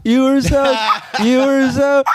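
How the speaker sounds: high-pitched mock drunk with hiccups; drunken hiccups. Host imitating a drunk for his friends, hammed-up hiccuping. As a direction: break the words up with fake hiccups. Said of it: "hiccups are alcoholic blackface".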